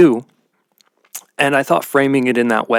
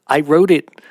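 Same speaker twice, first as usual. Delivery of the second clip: usual speaking voice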